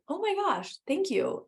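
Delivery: surprised delight at a kind stranger; grateful gush. Retold or imitated. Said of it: "It's like".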